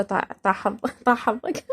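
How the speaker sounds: soft curse broken by giggles; giggling curse. Shown as a demonstration. Repeating the Iraqi curse for viewers, giggling softly. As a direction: laugh through the curse "طاح حظك". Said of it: "هذه المسبة".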